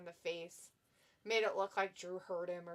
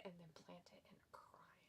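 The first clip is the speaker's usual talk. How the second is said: whispering; 2 semitones lower, 19 dB quieter than usual. Pretend. Whispered suggestion of framing someone.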